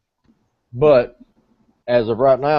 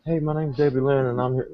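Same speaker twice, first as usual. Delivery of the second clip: lightly mock a fake sugary self-introduction; mock sweet intro voice; 3 semitones higher, 6 dB quieter than usual. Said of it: "that's not why I'm going like".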